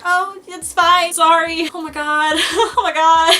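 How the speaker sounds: high, loud, slow, stammered reassurance with a laugh; flustered. Retold or imitated. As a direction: gush and giggle nervously. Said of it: "I was like".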